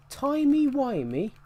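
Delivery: high, drawn-out incredulous question; John Hurt. Acted. Gamer quoting a favourite Doctor Who line, incredulous. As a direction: stretch it out with pitch shooting up. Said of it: "I love John Hurt's line".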